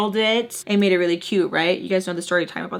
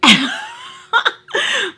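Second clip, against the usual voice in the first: high burst of laughter. Real, not acted. Laughing after calling the lady ugly, high squealing laugh.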